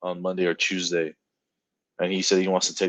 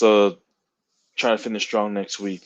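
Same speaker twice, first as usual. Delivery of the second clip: ordinary talk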